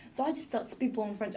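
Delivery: usual speaking voice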